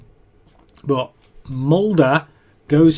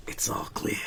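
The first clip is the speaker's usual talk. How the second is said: hushed, near-whispered impersonation; Dewey from Scream; 6 semitones lower, 16 dB quieter than usual. Retold or imitated. Mulder checking the bathroom, in a hushed impersonation. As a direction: drop low and whisper it, very softly. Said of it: "his best kind of Dewey from Scream impersonation".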